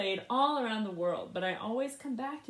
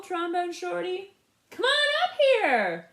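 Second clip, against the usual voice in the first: much higher, loud, slow stage call; 10 semitones higher, 9 dB louder, slower than usual. Acted. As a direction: call the kid up onstage. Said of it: "Bo Diddley said".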